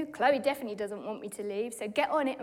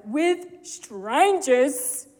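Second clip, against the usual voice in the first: drawn-out, louder, slow wail; daughter's plea; 2 semitones higher, 9 dB louder, much slower than usual. Retold or imitated. Daughter dreading a night alone with strangers, slow wail. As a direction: stretch the words out in dismay. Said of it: "her words were".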